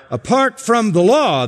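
apart from the law lifted high with a falling stress on law; emphatic phrasing. Shown as a demonstration. Preacher shows the Greek emphasis to the congregation, emphatic stress. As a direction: give apart from the law the weight of the emphatic position. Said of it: "This is in the emphatic position".

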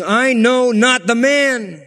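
high, drawn-out emphatic denial; swearing denial. Acted. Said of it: "Then he began to curse and to swear, saying".

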